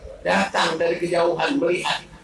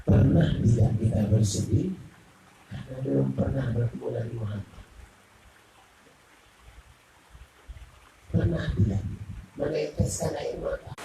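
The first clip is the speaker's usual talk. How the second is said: tender appeal; 7 semitones lower, 4 dB quieter than usual. Real, not acted. Soft, moving closing appeal.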